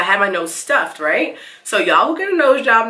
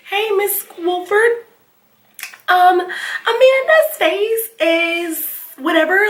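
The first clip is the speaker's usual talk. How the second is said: slow, higher, hesitant friend on the phone; 12 semitones higher, much slower than usual. Retold or imitated. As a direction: drag it out, vague and unsure, higher. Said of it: "Katie was like".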